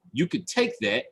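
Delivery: ordinary delivery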